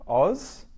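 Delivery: "Oz" said high, like OZZ; "Oz" clipped off Australia, high pitch; first syllable. Shown as a demonstration. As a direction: Oz lifted and held. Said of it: "the very first syllable".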